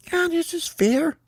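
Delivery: high, squeaky reply; squeaky cartoon teammate; agreeing bird. Team answers in agreement.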